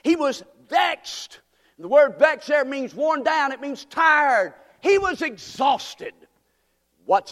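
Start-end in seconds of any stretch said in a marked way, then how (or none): 0.0-6.1 higher, slow, drawn out; preaching at full force. Acted. Preacher explaining vexed to the congregation, fervent. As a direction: press each phrase home.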